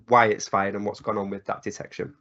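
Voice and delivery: ordinary talk, nothing marked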